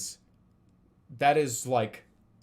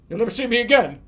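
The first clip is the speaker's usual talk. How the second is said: huffy dart guy; 7 semitones higher, 10 dB louder than usual. Retold or imitated. Dart guy leaving huffily.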